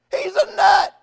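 high, loud dismissive jeer; acted scornful outburst; mocking dad. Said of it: "Daddy starts laughing".

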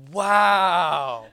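long, drawn-out, loud wow; acted amazement. Preacher replaying his reaction to friend's advice, acted amazement. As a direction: replay being floored, stretching the word out.

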